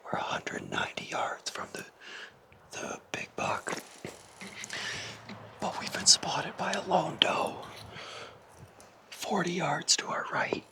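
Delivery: tense hushed whisper; whisper, deer are close; wildlife watching whisper. Real, not acted.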